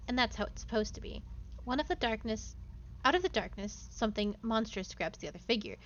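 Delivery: dramatic game narration; story narration. Acted. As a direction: read it like a dark tale. Reads game narration aloud.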